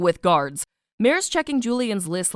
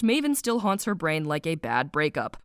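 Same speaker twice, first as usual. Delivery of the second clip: usual speaking voice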